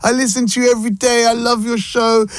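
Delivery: high-pitched gushing fan imitation; imitated starstruck excitement; gassed fan. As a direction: gush it high, thrilled to meet your idol. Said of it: "he was so gassed".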